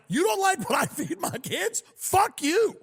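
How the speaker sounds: high-pitched, indignant comeback; defiant woman. Retold or imitated. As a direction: hurl it back as a mock defiant mother. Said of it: "it's a fat woman who's like".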